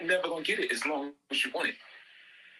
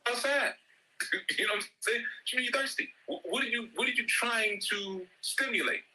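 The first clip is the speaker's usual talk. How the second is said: higher, mock-outraged parent, fast at the end; exasperated parent rant; 7 semitones higher than usual. Parent mock-scolding a child who says he is thirsty. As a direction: scold the lazy kid, half-laughing.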